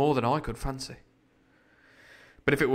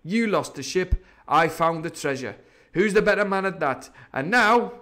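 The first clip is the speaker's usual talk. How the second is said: higher-pitched pirate boasting; Silver, indignant pirate; 5 semitones higher, 4 dB louder than usual. Acted. Silver argues he found the treasure, indignant. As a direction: press your case as the wronged captain.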